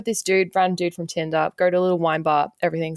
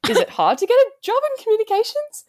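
much higher, over-keen question; forced interest; 12 semitones higher than usual. Retold or imitated. Asking the date about communications jobs, forced bubbly. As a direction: ask with exaggerated chirpy curiosity.

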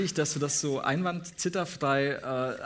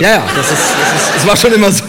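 loud over laughter; 14 dB louder than usual. Real, not acted. Shouting over crowd laughter.

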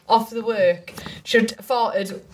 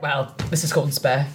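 lower cat voice; 8 semitones lower than usual. Retold or imitated. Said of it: "they're like".

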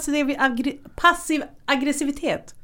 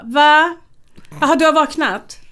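mock surprise; 5 semitones higher, 7 dB louder than usual. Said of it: "Jag bara".

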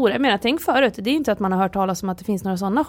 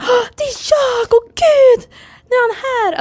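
much higher, overjoyed cries; thrilled audience member; 6 dB louder, slower than usual. Retold or imitated. Imitating a thrilled audience member answering the medium, high and excited. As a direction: gasp it out as if the dead relative just appeared.

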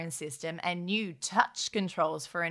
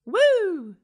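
excited cheer; 10 semitones higher, 8 dB louder than usual. Real, not acted.

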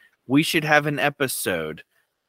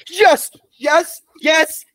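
high, loud cheering; excited shouting; 12 semitones higher, 7 dB louder, slower than usual. Real, not acted. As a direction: cheer like you just won. Cheers for space campaign.

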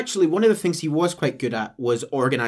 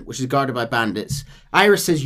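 usual speaking voice

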